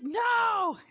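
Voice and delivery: ordinary delivery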